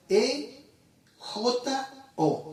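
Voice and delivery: E J O spelled letter by letter, high and slow; name each letter of E J O apart; spelling out letters. Shown as a demonstration. Teaching the Greek word, spells E J O letter by letter. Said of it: "en el griego es e-, ejo, o sea".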